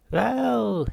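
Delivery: sing-song greeting of a smug therapist; Halfrunt voice. Acted. Psychiatrist voiced.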